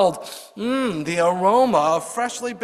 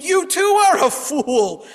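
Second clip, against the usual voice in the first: high, loud, slow furious outburst; angry scream; 10 semitones higher, 5 dB louder, slower than usual. Retold or imitated. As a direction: leap up and scream it at him. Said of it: "The rabbi jumped up and screamed".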